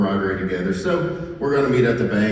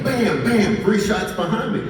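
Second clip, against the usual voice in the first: ordinary talk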